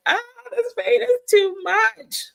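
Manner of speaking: high, hammed-up cry of pain; mock crying wail. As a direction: wail it like it's all too much, half joking. You're like.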